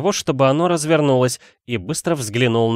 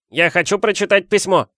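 put-on high insistent boy voice; Dudley, loud demand; 8 semitones higher than usual. A narrator's reading. Son to his parents, high loud demand. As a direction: demand it like a spoilt child. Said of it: "громко заявил он".

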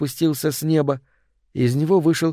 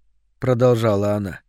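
plain reading voice

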